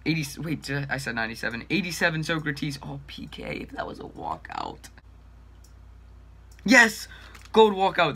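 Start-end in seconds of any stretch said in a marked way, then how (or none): 2.8-4.9 whispering. Real, not acted. Hushed hoping aside. 6.7-8.2 loud, high cheer; excited shout. Real, not acted. Walkout celebration.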